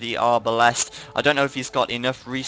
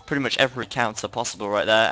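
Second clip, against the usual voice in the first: ordinary talk, nothing marked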